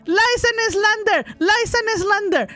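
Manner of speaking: high, indignant cry of slander; mock outrage. Real, not acted. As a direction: protest like a wrongly accused innocent. Outraged denial of dog blame.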